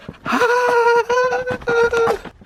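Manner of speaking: high nervous laugh; relieved laugh. Real, not acted. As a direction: laugh out the tension. Laugh after passing beehive.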